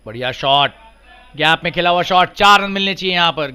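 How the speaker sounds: loud, high call of a boundary four; excited cricket commentary. Real, not acted. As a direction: build to a shout as it crosses the rope.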